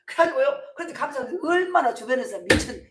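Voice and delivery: usual speaking voice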